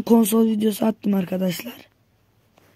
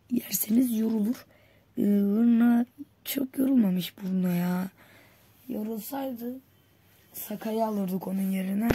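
whisper; 5 dB quieter than usual. Real, not acted. Whispered aside during match.

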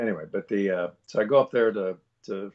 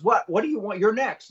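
much higher, exasperated snap; impatient cut-in; 10 semitones higher, 5 dB louder than usual. Retold or imitated. Bartendress cutting off a hesitant customer, impatient. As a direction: cut them off, pushy and pitched up. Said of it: "she cuts me off".

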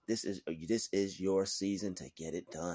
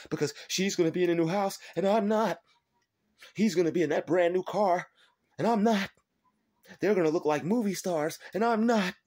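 higher, louder mock panicked envy; jealous whining; 6 semitones higher, 4 dB louder than usual. Jealous enemies fretting over the new house, mocking. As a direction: whine like a scared rival. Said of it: "They're like".

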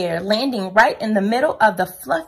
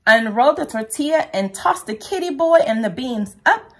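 plain reading voice

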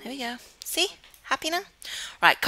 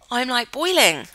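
real Cornish accent, higher and louder; 4 semitones higher, 9 dB louder than usual. Real, not acted. Player complaining of overheating from tea, in a Cornish accent. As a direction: let your Cornish slip out, loudly. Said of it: "hear my Cornish accent coming out".

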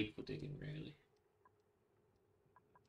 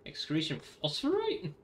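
loud, higher-pitched startled question; baffled, raised-voice double-check; incredulous; 6 semitones higher, 7 dB louder than usual. Real, not acted. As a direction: read it out in loud disbelief. Startled by what it excretes.

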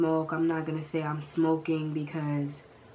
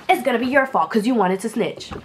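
loud, higher blaming outburst; loud accusation; 5 semitones higher, 9 dB louder than usual. Retold or imitated. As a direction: yell the blame at her.

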